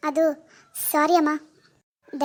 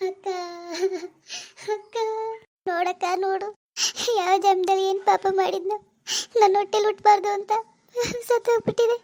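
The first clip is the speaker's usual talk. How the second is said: sobbing lament to a sister; weeping. Acted. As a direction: wail brokenly, gasping between phrases. Mother wailing over dead baby.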